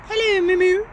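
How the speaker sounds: high, loud cooing hello; pet greeting. Real, not acted.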